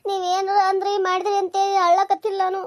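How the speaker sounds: ordinary delivery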